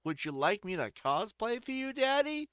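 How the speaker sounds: lightly voiced flirty woman, rising high on Daddy; playful mock come-on, lifting at the end. Woman offering to cosplay for Daddy, mock flirty. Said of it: "She's like".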